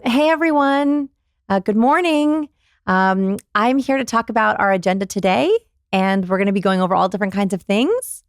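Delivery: "everyone", "morning", "today", "things" all lifted like questions; constant question inflection. Shown as a demonstration. Showing a weak meeting opener, questioning rises throughout. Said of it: "It sounds like this".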